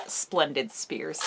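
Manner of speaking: usual speaking voice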